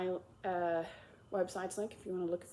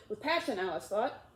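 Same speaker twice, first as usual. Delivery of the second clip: ordinary delivery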